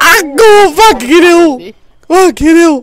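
high-pitched cries of pain; agonised cursing. Acted. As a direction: curse loudly through the agony. Victim cries out in agony.

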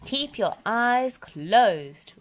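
ordinary delivery

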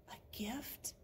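hushed, voiceless question; barely audible whispered query. A narrator's reading. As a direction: whisper it in wonder. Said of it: "whispered Murray".

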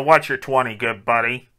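usual speaking voice

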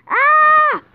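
short loud panicked yell; panicked shout. Real, not acted. Character drowning underwater.

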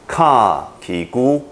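ka ki gu stretched out for the class; very slow spelling. Shown as a demonstration. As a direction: drag each syllable slowly. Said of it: "我们看第二个".

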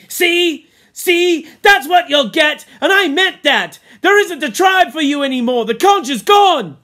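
put-on furious boy screaming at the top of his lungs; wild scream. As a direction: scream it wildly, high and loud. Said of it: "he began to scream wildly".

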